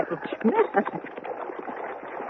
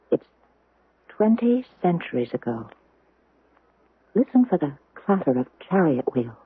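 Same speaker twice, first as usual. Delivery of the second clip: guided imagining; 7 semitones lower than usual. Acted. Teacher guiding class to imagine. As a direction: draw them into the past. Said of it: "Class, let's close our eyes".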